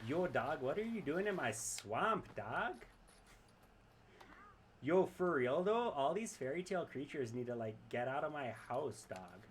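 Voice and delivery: loud, high hammed-up Toronto accent as Shrek; a bad Toronto-accent Shrek, goofy and punchy. Streamer jokingly voices Shrek in a hammed-up Toronto accent. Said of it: "did a Toronto accent for Shrek".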